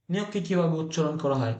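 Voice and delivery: usual speaking voice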